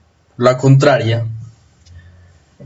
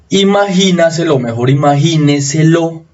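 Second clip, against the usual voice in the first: ordinary talk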